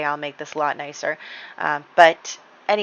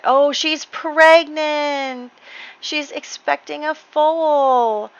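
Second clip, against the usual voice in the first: high, slow, delighted gasp of news; higher, slowly, marvelling at the news; delighted surprise; 9 semitones higher, 3 dB louder, much slower than usual. Real, not acted. Discovers her horse is pregnant.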